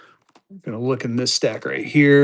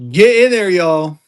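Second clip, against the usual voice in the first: loud, raised-pitch call to buyers; hype urging; 2 semitones higher, 7 dB louder than usual. Real, not acted. Seller urging viewers into the stack, hype urging.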